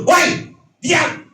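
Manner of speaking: high, loud shout at a demon; forceful high-pitched command; screamed rebuke. Acted. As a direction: yell it as an abrupt order. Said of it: "dia menjerit kepada setan tuh".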